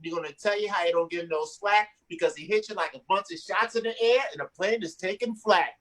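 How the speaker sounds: usual speaking voice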